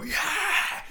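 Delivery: high-pitched roar of a charging gang; crowd battle cry. Acted. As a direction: yell like the whole crew joining in. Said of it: "and then all of them go".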